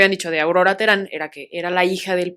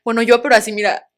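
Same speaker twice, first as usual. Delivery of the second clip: mock crying; 3 semitones higher, 10 dB louder than usual. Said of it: "llorando así".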